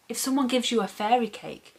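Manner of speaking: ordinary delivery, nothing marked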